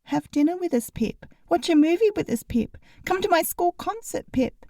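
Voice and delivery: put-on high, sing-song begging kid; whiny little pleading voice; pleading child voice. A narrator's reading. Mocking the kids' pleading.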